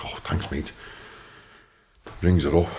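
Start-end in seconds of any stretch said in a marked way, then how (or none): 0.1-0.7 whispered, much softer sarcastic thanks; breathy aside. Retold or imitated. Thanking the fella under his breath, whispered. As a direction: drop to a dry whisper.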